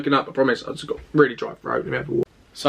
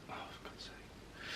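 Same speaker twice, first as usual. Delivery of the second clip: slow, barely voiced whisper; retold exasperation under the breath; 30 dB quieter than usual. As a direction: whisper it wearily to yourself. Disturbed in bed by a nurse.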